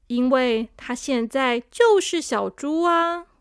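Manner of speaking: ordinary delivery, nothing marked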